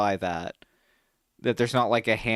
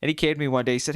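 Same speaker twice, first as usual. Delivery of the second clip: ordinary delivery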